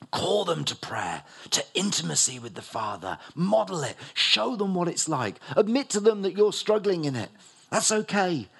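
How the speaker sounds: rising, loud preacher's exhortation; preaching at full force. Acted. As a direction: build the call like a revival preacher.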